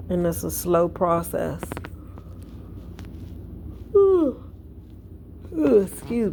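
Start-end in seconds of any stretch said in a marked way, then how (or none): 3.9-4.4 high loud yawning ooh. Real, not acted. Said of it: "I'm yawning". 5.5-6.3 talking through a yawn; words stretched by a yawn. Real, not acted. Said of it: "I'm yawning".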